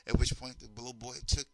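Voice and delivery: ordinary talk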